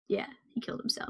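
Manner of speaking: whispered aside. Real, not acted. Quick aside after killer's death.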